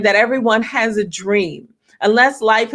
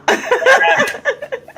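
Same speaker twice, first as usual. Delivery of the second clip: high bright laugh. Real, not acted. Guest laughs on joining call.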